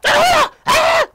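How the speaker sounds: very high, loud wordless shriek; mock screech of someone going off; mock screaming. Woman going off in a man's face, imitated screaming.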